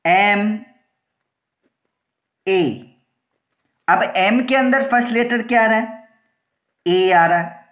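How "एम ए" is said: एम, long pause, then ए; teacher demonstrating एम ... ए slowly; letter-by-letter pronunciation. Shown as a demonstration. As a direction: sound out एम and ए as separate letters. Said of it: "जब इसको pronounce करेंगे तो क्या बोलेंगे".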